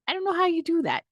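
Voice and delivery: incredulous high repeat; high-pitched disbelief. Real, not acted.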